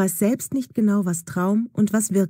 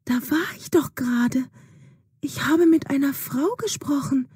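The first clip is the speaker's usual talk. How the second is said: whispered, higher disbelief; confused whisper; 7 semitones higher than usual. Acted. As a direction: whisper it, shaken and unsure. Dazed Juna insists quietly.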